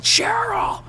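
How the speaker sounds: high, held-back mock scream; imitated scream. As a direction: scream it in miniature, high without the volume. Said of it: "just screaming".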